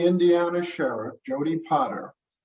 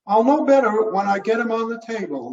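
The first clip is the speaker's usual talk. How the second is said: higher, brisk woman's voice; medical examiner voice; 7 semitones higher, 5 dB louder, faster than usual. Acted. Nancy answers sheriff.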